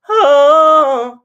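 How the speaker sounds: high, loud oh flipping down; deliberate voice crack. Shown as a demonstration. Coach demonstrating a controlled crack, loud. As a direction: crack from head into chest on purpose. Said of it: "purposefully made it happen".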